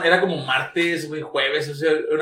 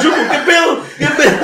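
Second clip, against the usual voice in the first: excited shout; 11 semitones higher, 5 dB louder than usual. Retold or imitated. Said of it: "que digo".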